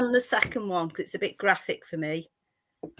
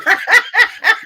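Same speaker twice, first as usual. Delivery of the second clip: a sudden burst of high, loud laughter; 12 semitones higher, 8 dB louder than usual. Real, not acted. Laughing at the head-twist joke.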